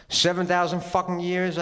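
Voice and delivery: high, fast disbelieving echo; incredulous echo. Acted. Narrator echoing God in disbelief, high and fast. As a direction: toss his words back, high and quick. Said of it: "I say?".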